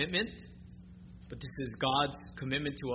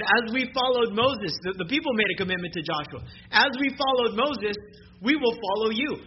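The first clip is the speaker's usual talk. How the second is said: higher and louder, the "Moses" quote rings out; full-force preaching; 6 semitones higher, 6 dB louder than usual. Acted. Preacher voicing the people's vow to Joshua, loud and raised. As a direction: proclaim the people's vow with fire.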